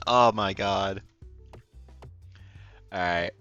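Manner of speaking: ordinary talk, nothing marked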